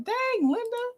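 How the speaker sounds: amazed little gasp of a name; surprised exclamation. Retold or imitated. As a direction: squeal it up high like you just found out. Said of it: "I'm like".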